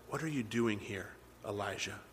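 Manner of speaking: very soft, low question; still small voice. Acted. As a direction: ask gently, almost under your breath. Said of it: "a still small voice".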